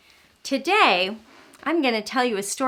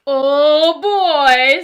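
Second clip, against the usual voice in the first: louder Papa Dinosaur boom; 3 semitones higher, 9 dB louder than usual. Acted. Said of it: "in his loud, booming voice".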